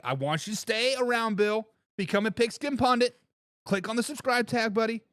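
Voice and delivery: ordinary delivery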